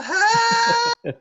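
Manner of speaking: very high, loud scream for help; hammed-up cartoon scream. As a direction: scream it out long and piercing. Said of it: "they're screaming out in the next panel".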